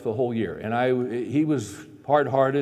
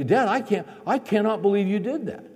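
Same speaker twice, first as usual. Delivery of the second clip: son's disbelief; 8 semitones higher than usual. Retold or imitated. Son protesting to his dad, incredulous. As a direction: play the stunned son.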